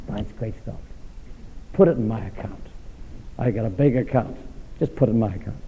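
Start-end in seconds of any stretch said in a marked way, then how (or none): 1.7-5.6 soft plea. Acted.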